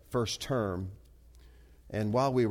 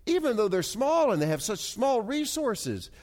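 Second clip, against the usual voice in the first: raised pitch with drawn-out key words; emphatic preaching; 7 semitones higher, 3 dB louder than usual. Real, not acted. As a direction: preach it with swelling conviction.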